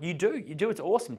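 playfully insist, pitch lifted; playful insistence. Acted. Acted playful insistence to girl.

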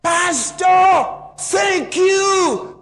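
loud, high joyful cry of thanks; retold woman shouting her gratitude, high and loud; woman yelling thanks. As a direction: shout it out to the pastor across the crowd. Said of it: "the woman yelled out".

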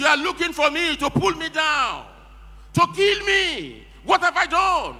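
high-pitched, pleading accusation; impassioned protest. Acted. Preacher voices David pleading with Saul.

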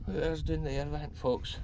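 ordinary delivery

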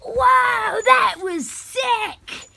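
high, drawn-out excited cheer; excited shout. Acted. Character amazed at the shooting, excited high shout. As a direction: whoop it out, stretching the last word.

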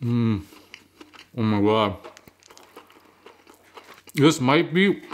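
talking while eating sandwich; words muffled by food; mouth full, chewing. Real, not acted. Seen 1: Holds sandwich at mouth, biting and chewing throughout the words.

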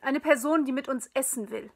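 laut und genervt gerufen; genervt zurückgerufen. A narrator's reading. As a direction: ruf es gereizt zurück. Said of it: "rief ich genervt zurück". (genervter Ruf zurück ins Wohnzimmer)

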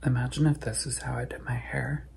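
soft whisper. Real, not acted. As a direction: whisper it to the camera. Unvoiced whisper heard.

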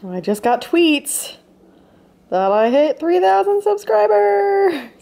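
high, loud and slow, drawing it out; thrilled news for her viewers; real delight at the milestone; excited. Real, not acted. Announcing hitting 3000 subscribers.

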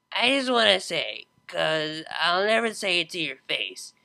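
put-on slow drunken rambling. A narrator's reading. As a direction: slur it out tipsy and fond. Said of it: "have you been drinking".

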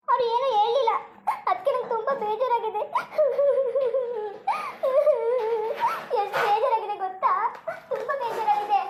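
sobbing between phrases; tearful. Acted. Upset woman confiding in her friend about his silence, tearful. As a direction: let the hurt break into acted sobs. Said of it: "ಕಣ್ಣೀರು ಒರೆಸು ನೀನು".

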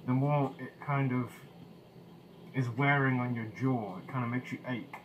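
muffled behind respirator faceplate; speaking through a gas mask. Real, not acted. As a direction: voice through speech diaphragm. Seen 0: Full-face respirator covers mouth throughout labelled words; R frames show mask in hands.

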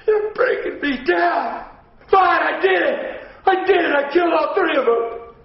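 crying, shouting confession, high and slow; sobbing breakdown. Acted. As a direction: break down wailing as you confess.

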